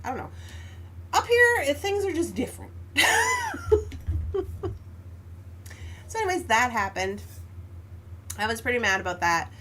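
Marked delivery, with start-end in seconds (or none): 2.8-6.0 high giggle. Real, not acted. Laughing at own remark.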